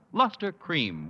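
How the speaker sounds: ordinary delivery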